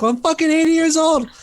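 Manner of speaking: put-on high-pitched indignant old-man retort; imitated defiant grandfather. Grandfather answering why he does heroin, high indignant imitation. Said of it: "It was his, the grandfather".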